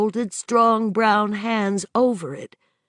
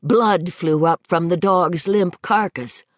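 usual speaking voice

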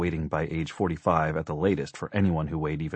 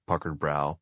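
usual speaking voice

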